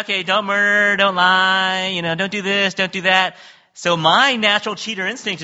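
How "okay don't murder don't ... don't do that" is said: high, loud sing-song commandments; lightly mocking preachy list; mock sing-song rule list. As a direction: rattle off rules like a nursery chant. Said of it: "it says".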